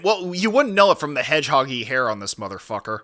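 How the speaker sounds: ordinary delivery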